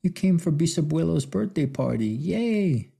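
lightly voiced children's happy shout; excited kids. A narrator's reading. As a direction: cheer like little grandkids. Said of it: "the kids shouted".

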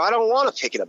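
high, whiny protest; a touch of childish whining; whiny refusal. Retold or imitated. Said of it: "And I go".

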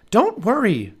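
put-on high, breezy young girl; little girl voice. A narrator's reading. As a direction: brush it off in a high girl's voice. Narrator voices Annie.

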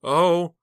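sharp high gasp of alarm; startled gasp. Acted. Heather gasps after screaming at her uncle. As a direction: gasp at what slipped out.

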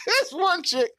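laughing while telling. Real, not acted. Laughing through his retelling.

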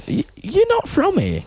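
high-pitched mock English local; English accent. Comic playing English locals spotting an outsider, high English accent. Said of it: "they'd be like".

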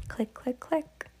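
high soft click, click, click; clicking doll feet. Acted. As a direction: tap it out like tiny feet.

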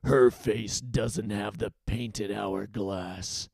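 slow, low villain snarl; snarling captain. A narrator's reading. Captain sizes up the intruder, snarling. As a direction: snarl low, stretching the words out. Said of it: "snarled the captain".

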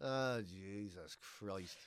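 low, soft, slow weary groan; exasperated groan. Real, not acted. Hearing the menu's pave rump, a low slow groan. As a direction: sag under it in disbelief. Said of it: "Pave rump".

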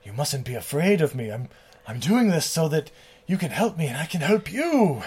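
lightly voiced solemn vampire; mock grand pleading; Blackula earnest appeal. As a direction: reassure with measured, theatrical earnestness. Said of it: "he's like".

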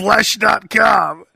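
very slow, crying out each word; mock dramatic. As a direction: drag out "Flesh dot com" like a wail. Mock dramatic website name.

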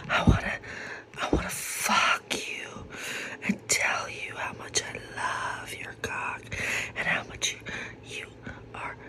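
sensual ASMR whisper. Shown as a demonstration. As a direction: whisper it close and seductive. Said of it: "But here we go".

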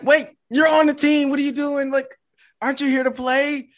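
can't believe they won't play; a startled coach's mock outrage; incredulous coach. Coach incredulous at unwilling player.

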